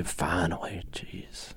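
breathy unvoiced whisper. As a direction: whisper it under your breath. Imagined muttering after door closes.

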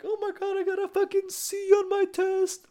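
very high, loud, hammed-up girly wail; exaggerated high-pitched tearful whine; mock crying girl. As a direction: imitate a girl bawling over a bad grade. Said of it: "Fucking cry their eyes out".

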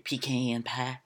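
drawled, put-on country accent. Podcaster mocking how country pecan pie sounds, country accent. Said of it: "Ooh, that's so country".

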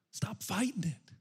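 slow whisper; soft whispered urging; hushed plea. Acted. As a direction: plead gently under the breath. Pleading hush in sermon.

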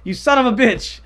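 loud, high-pitched exasperation; exasperated outburst. Real, not acted. Telling off a co-host who derailed the story, exasperated.

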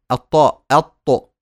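أط loud with an emphatic ta; letter demo. Shown as a demonstration. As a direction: say أط hard and loud. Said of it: "انطقوا وقولوا".

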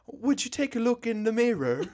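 high, hammed-up old-time British accent; old-time British man. Co-host mocking his own way of saying mirror, mock British accent. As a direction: put on a sixteen-hundreds British gentleman. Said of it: "I sound like an old-time sixteen hundreds, like, British man".

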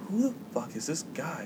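very soft aside between friends; covert aside. Retold or imitated. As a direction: say it under your breath, puzzled. Retold aside about the stranger.

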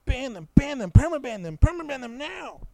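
very high, loud mock demand; over-the-top pretend fury; hammed-up outraged shouting. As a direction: shriek it like an offended internet mob. Acting an outraged online complainer.

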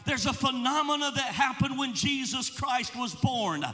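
fervent sermon climax; full-force preaching. Acted. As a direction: build the congregation up. Preaching over music, building.

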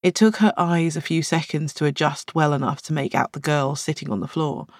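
ordinary delivery

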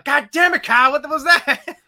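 half-laughing, fed-up fan outburst; cousin's exasperated yell. Retold or imitated. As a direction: cousin yelling at the coach from his lawn. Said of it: "My cousin on top of his lawn".

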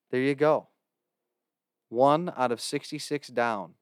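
normal talking voice

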